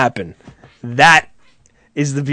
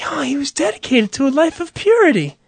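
admiring mourner; 11 semitones higher than usual. Acted. People marveling over the dead man, high mock admiration.

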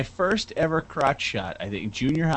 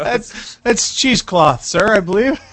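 mock posh; 7 semitones higher, 4 dB louder than usual. Prissy correcting aside.

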